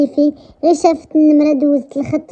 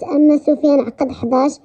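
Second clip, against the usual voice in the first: usual speaking voice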